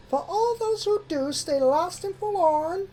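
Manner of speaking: high filly voice reciting; reciting a rhyme. A narrator's reading. Apple Bloom reciting her sister's rhyme, high filly voice. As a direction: recite it like a learned nursery rhyme. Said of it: "Apple Bloom recited the rhyme".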